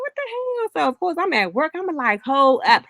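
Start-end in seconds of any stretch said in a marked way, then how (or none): 0.0-0.7 sudden high startled cry; retold shock at the phone call, pitched way up; shocked high yelp. Son hearing his mom crying on the phone, startled high yelp. 2.2-2.8 louder, higher worried questions; alarmed demand. Retold or imitated. Son at work hearing mom cry, alarmed louder demand.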